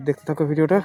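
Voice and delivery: usual speaking voice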